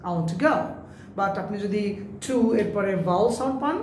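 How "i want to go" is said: "to" squeezed to tuh inside "I want to go"; quick weak form. Shown as a demonstration. Teacher modelling to before a consonant, quick weak form. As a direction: run "want to go" together with a tiny "to". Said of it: "দ্রুত উচ্চারণ করতে পারবেন".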